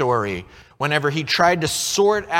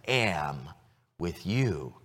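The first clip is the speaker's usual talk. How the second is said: reassuring God; 6 semitones lower, much slower than usual. Acted. God reassuring Moses, low slow tender promise. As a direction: lean in and let each word land gently.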